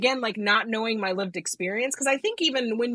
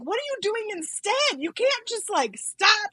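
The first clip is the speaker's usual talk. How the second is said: exasperated scolding pitched way up; mock-mad friend; 12 semitones higher, slower than usual. Sober friend challenging her for just stopping, high mock exasperation. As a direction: play the appalled friend, voice high and insistent. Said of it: "so mad at me".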